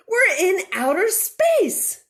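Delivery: high, loud, slow joyous cry; excited shout. Acted. Minnie shouts to Moo as they fly, loud and high. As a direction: shout it in wonder. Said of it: "shouted Minnie".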